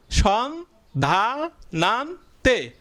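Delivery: clipped syllable-by-syllable reading; syllables separated. Shown as a demonstration. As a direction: say সন, ধা, নান, তে as separate beats. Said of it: "চারটা অংশ হয়ে গেছে".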